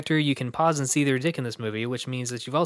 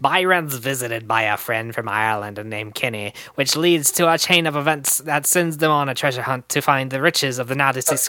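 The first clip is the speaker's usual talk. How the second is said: louder, hammed-up fake Irish read breaking into laughter; campy mock Irish; 6 dB louder than usual. Host reading the movie summary in a terrible campy Irish accent. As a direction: camp it up in a terrible Irish brogue. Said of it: "That wasn't Irish at all. That was just campy".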